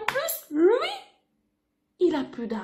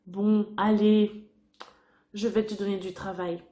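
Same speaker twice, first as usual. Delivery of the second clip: deep-voiced man agreeing reluctantly; gruff farmer; 11 semitones lower, 5 dB quieter than usual. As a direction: give in with a deep sigh. Man's deep voice.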